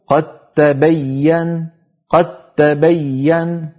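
slow qat-tabayyan for قد تبين; no trace of د in قد, hold the t; merged doubled ta. Shown as a demonstration. Teacher showing the second case, doubled t. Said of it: "فلا يبقى للدال أثر إن وصلنا".

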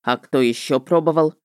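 небрежный вопрос ведьмака; спроси как бы между прочим; небрежно. A narrator's reading. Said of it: "а потом небрежно поинтересовался". (небрежно)

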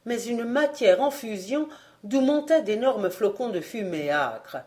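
ordinary delivery, nothing marked

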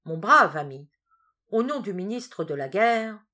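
a doctor presenting a medal, proudly loud; solemn proclamation. Acted. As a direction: announce it grandly to the hero.

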